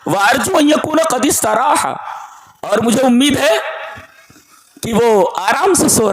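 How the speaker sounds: high, fast Arabic recitation; fervent recitation. Acted. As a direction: rush the quote up high. Quoting the mother's hopeful words.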